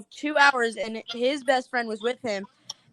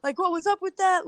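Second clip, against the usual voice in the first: higher, quicker retold disbelief; amazed; 7 semitones higher than usual. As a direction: rattle off his stunned reaction. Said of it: "He's like".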